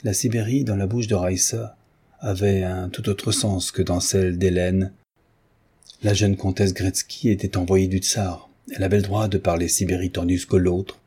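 usual speaking voice

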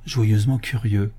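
usual speaking voice

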